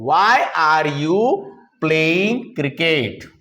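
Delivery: very slow, drawn-out words; word-by-word modelling. Shown as a demonstration. Teacher modelling the finished question for students, word-by-word modelling. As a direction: model it slowly for beginners.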